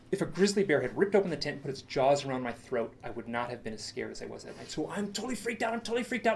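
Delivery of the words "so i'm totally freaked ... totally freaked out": high, tense, repeated insistence; freaked out. Retold or imitated. Camper recalling terror in the tent, freaked out. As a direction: pitch it high, as if reliving the panic.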